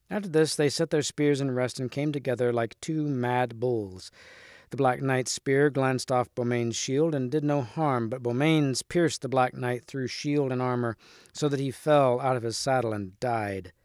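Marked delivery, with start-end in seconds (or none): none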